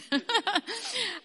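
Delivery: short high embarrassed laugh. Real, not acted. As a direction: laugh at the slip. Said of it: "difficult to say".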